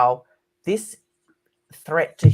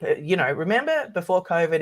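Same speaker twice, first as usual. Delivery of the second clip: ordinary talk, nothing marked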